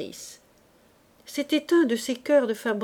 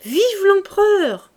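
much higher, loud, slow drawn-out cheer; cheering shout; 10 semitones higher, 9 dB louder, slower than usual. Acted. Escort hailing the emperor, slow full-throated cheer. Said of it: "l'escorte cria".

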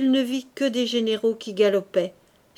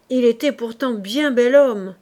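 louder remark; 7 dB louder than usual. Acted. Cantinière speaks of dead man.

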